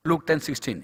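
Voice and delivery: ordinary talk, nothing marked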